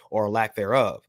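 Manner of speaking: usual speaking voice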